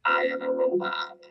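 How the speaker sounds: flat whispery robot effect. Real, not acted. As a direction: voice run through a robot filter. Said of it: "I have a robot voice effect on this thing".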